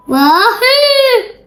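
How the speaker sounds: high, loud sung wahoo; excited whoop. Real, not acted. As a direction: whoop it out in delight. Cheering while mixing.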